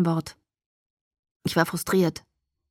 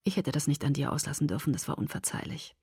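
much softer remorseful words; 4 semitones lower, 10 dB quieter than usual. Acted. Edward apologizing to Alice, quietly remorseful. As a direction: sink into quiet regret.

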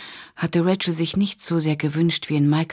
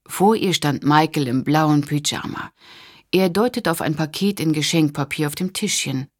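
ordinary delivery

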